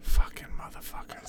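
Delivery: hushed, breathy swearing under the breath; whispered curse. Real, not acted. Cursing the vet clinic under his breath, whispered. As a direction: hiss it as a private aside.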